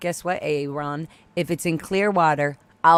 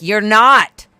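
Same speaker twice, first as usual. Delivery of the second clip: loud, higher scolding; raised voice warning the owner; loud retort; 4 semitones higher, 10 dB louder than usual. Retold or imitated. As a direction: shout her down. Shouting down the owner.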